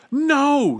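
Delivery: very high, loud cry of warning; urgent high-pitched shout. A narrator's reading. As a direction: shout to stop him. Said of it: "shouted Bartholomew".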